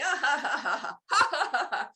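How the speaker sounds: a high, hearty laugh performed on purpose; demo belly laugh. Shown as a demonstration. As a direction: show the laughter yoga laugh, big and free. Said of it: "I'm laughing".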